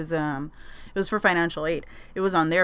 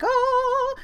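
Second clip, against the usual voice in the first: very high squeal of delight; 3 dB louder than usual. Retold or imitated. Remembering her delight at the old building.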